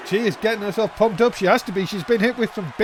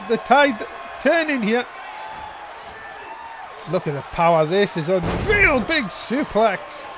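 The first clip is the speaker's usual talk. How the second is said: building ringside play-by-play; wrestling commentator; 2 semitones higher than usual. Real, not acted. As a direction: call the near fall, rising.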